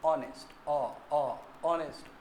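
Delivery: "ऑनेस्ट" opening on an open "o" vowel; quiet, low demo of "ऑनेस्ट" without any h; silent-h pronunciation. Shown as a demonstration. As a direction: sound out the vowel "ओ" that starts "ऑनेस्ट". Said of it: "किस तरह उच्चारण करोगे".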